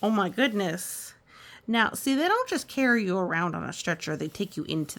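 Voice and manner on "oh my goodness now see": plain reading voice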